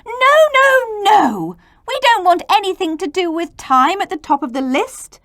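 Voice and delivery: high, loud protest; children refusing T loudly; children shouting. Acted. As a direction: shout them down. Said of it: "the children shouted".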